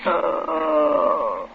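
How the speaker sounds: low broken sob. Acted. Wife sobs after the killing. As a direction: let it crack into a sob.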